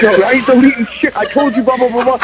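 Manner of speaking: high, heated retort; flipping out. Retold or imitated. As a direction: blow up at the guard. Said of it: "I was flipping out at him".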